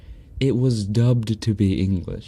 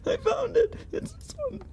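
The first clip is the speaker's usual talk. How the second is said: laughing squeal; faster than usual. Real, not acted. Laughing while finding clip.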